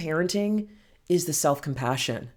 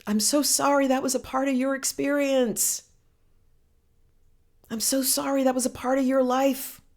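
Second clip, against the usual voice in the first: raised-pitch, tender consoling; emotional compassion; 7 semitones higher than usual. Shown as a demonstration. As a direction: console as if moved by your own words. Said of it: "I get emotional just saying those words".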